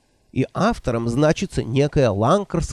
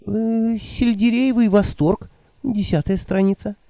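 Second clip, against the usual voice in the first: put-on higher, fluttery old woman voice; 8 semitones higher than usual. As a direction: play the flustered old witch. Nanny voiced by narrator.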